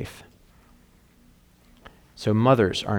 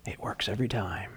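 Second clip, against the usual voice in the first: much softer secret whisper; 2 semitones lower, 12 dB quieter than usual. Acted. Santa whispers his secret to the clone, soft and sly. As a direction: let the clone in on the trick, barely voiced. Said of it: "Santa whispered to the clone".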